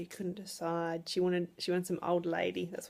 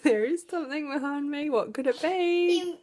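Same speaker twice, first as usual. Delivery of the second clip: high, loud singsong pretend wonder; playful mock suspense; 7 semitones higher, 6 dB louder than usual. Mum playing along with the child hiding behind her, mock wonder. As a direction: play along, exaggerated and laughing, pitch climbing.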